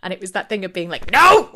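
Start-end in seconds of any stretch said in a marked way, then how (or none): 1.1-1.5 high, very loud outburst; hammed-up explosive cry; mock outraged shout. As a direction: yell it in imitated exasperation. Acts out the group's outburst.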